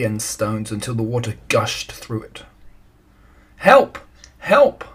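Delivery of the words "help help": loud, high cries of help, rising at the end; shouting for help. A narrator's reading. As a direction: call out desperately for anyone to hear. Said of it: "he shouted".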